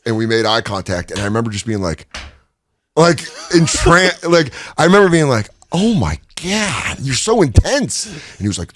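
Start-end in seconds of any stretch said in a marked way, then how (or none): 5.7-8.1 starstruck awe. Retold or imitated. Awestruck meeting Macho Man.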